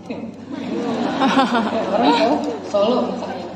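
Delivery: laughing through the words. Real, not acted. Laughing as he jokes about his wife. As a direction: chuckle while saying it.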